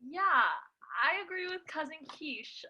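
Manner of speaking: ordinary delivery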